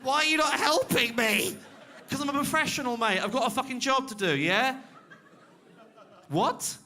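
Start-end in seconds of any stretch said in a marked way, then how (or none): 0.0-1.6 high, loud, slowed cry for help, hammed up; mock panicked heckler. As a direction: ham up a desperate man begging for help. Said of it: "the man ordered me going".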